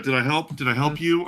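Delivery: ordinary talk, nothing marked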